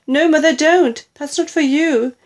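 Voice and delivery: high, loud, urgent plea; crying out. A narrator's reading. Daughter warning mother off the drink, loud alarmed plea. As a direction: plead in alarm to stop her. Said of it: "cried Missus Cranky".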